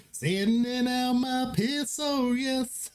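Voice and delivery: high, slow country singing voice, hammed up. Shown as a demonstration. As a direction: croon it like a joking country singer. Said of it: "in a completely in, like, a country voice".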